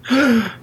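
high, sharp gasp; mock gasp. Host hearing that she has Mew, high amazed gasp.

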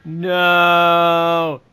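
loud cry of dismay; horrified shout. Real, not acted. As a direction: shout it out in horror. Horrified outburst at story.

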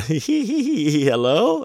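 high, dreamy "Hello?" into the phone; lightly voiced giggly stoner; stoned friend answering. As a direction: play the baked buddy picking up, clueless.

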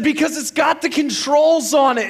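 high, loud, exasperated explanation; acted yelling. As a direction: act out a shouting half-asleep husband. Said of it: "You are screaming".

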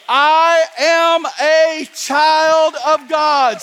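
slow, loud, ringing declaration; a preacher's slow chant for the crowd to echo; declared slowly. Shown as a demonstration. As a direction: proclaim each word, drawn out. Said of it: "Somebody say it with me".

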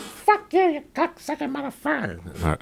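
woman cursing the pursuing cop, very high screech. Retold or imitated. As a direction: shriek it at him then trail off.

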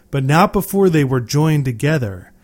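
ordinary delivery

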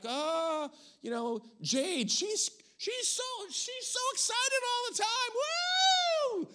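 squealing very high mock voice ending in a whoop; hammed-up over-the-top excitement; gushy imitation of Jade. Pastor mimics the church imitating Jade, squealing and giddy. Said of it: "we're like".

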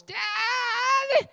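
put-on very high-pitched little girl shriek, dragged out; hammed-up high-pitched daughter's cry. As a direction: wail it as an outraged daughter at the top of her voice. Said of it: "cries out at the top of her voice".

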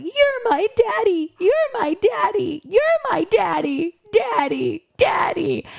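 put-on very high, babyish daddy chant; childish clinging. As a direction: coo it like a little girl hugging dad. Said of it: "is like".

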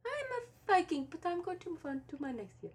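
squeaky high, fast Viking voice; high-pitched Viking voice. Pretend. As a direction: play a Viking with a tiny high voice.

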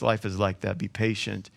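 soft, low read of James; quiet scripture read. Acted. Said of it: "James says".

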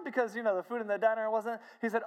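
ordinary talk, nothing marked

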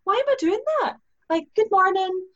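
squeaky high self-mocking voice; mock yourself in a squeaky voice; high exasperated self-talk. Host mocking her own phone habit, squeaky high. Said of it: "I'm going".

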